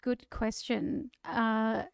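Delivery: ordinary talk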